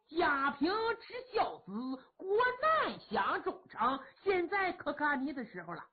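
put-on high, playful old minister teasing the dead; sly joking minister. Kou Zhun cheerfully coaxes the dead aunt out of her coffin, mock-pleading. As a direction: coax the coffin like a sly prankster.

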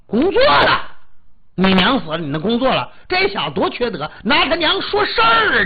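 high, loud, incredulous outburst; outraged shouting. Acted. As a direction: shout it as an indignant bystander. Onlookers react in outrage.

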